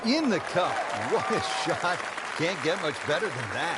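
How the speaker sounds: thrilled shout as the putt drops; excited. Real, not acted. Ball drops, commentator reacts.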